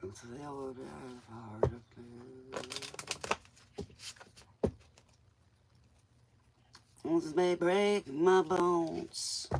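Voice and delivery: drawn-out, soft and low; slow low reading. Acted. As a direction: read it slowly, almost to yourself. Reads card slowly.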